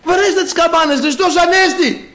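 high excited acted cry; calling out. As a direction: shout to the street as an elated grandmother. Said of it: "Φωνάζει". (ψηλή, ενθουσιασμένη φωνή γιαγιάς)

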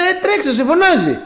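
high, loud urging call; urgent shouting. Retold or imitated. Villagers shouting at the blind man to run, high and loud. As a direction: yell at him to hurry up and run. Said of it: "Του λένε".

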